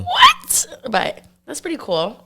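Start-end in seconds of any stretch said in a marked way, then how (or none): 0.0-0.7 sudden high-pitched shout of disbelief; shocked what. Retold or imitated. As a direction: blurt it out, stunned. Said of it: "I was like".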